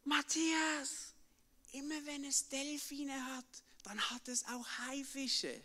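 lightly voiced distant friend calling; a hint of Pedro calling from afar; Pedro calling back. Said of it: "ruft mir zu".

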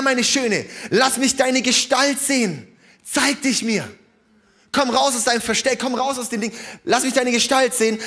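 high, loud impassioned proclaiming; preacher proclaiming at full force; fervent preaching. Acted. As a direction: call them out with passion.

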